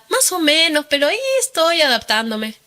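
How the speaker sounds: high-pitched, retold so-so answer; putting on coping. Woman answering cousin on the phone, lightly feigned ease. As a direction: play down trouble in a lifted voice. Downplays her troubles to cousin.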